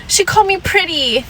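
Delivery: high whiny mock of a confused Karen; high, whiny imitation of a flustered woman. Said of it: "what are they gonna do".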